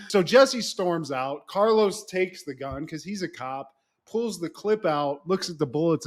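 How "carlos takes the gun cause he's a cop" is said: usual speaking voice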